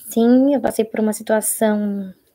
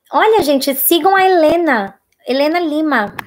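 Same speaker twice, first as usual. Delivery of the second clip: higher and louder, slow emphatic plug; excited shout-out; 6 semitones higher, 5 dB louder, slower than usual. Real, not acted. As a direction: hype up the fan's channel. Loudly promoting a fan's channel. (anunciando a Helena com entusiasmo)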